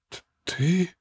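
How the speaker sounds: stammered breathy gasp; breathed out. Acted. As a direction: barely breathe the word out. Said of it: "только и смог выдохнуть".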